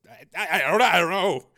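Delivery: high, stumbling, flustered answer; nervous stammer. Acted. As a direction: stammer it helplessly. Frightened scientist stammers to major.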